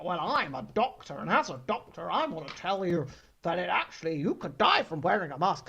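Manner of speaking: lightly voiced smug fake doctor; a hint of pompous self-declared expert; mock doctor. Host mocks fake doctors on Twitter, lightly pompous. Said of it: "being like".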